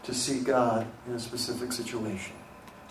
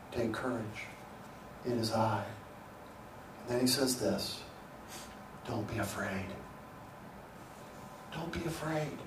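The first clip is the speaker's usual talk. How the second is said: much softer, hushed comfort; tender reassurance; 4 semitones lower, 11 dB quieter than usual. Acted. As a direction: speak it like calming a frightened friend. Softly quoting Jesus' comfort.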